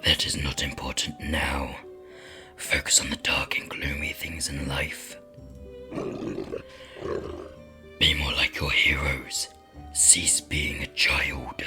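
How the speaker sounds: deep raspy demon whisper. Acted. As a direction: hiss it like a lurking demon.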